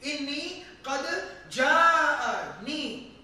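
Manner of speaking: slow, with "ja'ani" loud and drawn out; emphatic. Shown as a demonstration. Teacher showing the third degree, "ja'ani" said with heavy emphasis. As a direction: lean on "qad", then punch "ja'ani". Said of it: "your third degree of emphasis".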